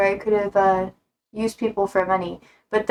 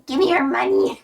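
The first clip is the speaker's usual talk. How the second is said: gold digger; 7 semitones higher than usual. Pretend. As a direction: play a grabby gold digger. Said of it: "That's a gold digger".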